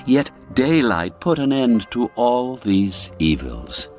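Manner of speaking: ordinary delivery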